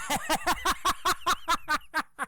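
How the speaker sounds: high breathy gloating laugh; wife's cackle. Retold or imitated. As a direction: cackle in triumph. Wife laughs.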